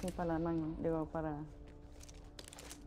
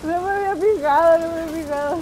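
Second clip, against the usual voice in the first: louder, higher, right after a big laugh; loud realisation; 7 semitones higher, 8 dB louder than usual. Real, not acted. Player admits not having noticed, loud realisation. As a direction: blurt it out loud and high.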